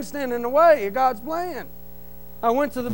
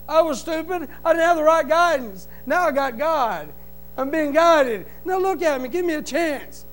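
ordinary talk

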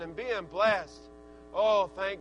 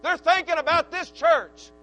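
loud, raised, driving sermon voice; full-force preaching; 4 semitones higher, 7 dB louder than usual. Real, not acted. Preacher rousing the church about young people, full-force preaching. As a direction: preach it hard, climbing to a high, loud peak.

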